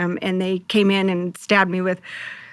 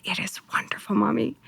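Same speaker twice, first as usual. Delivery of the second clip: whispered; 9 dB quieter than usual. Retold or imitated. Son describing heaven in dream.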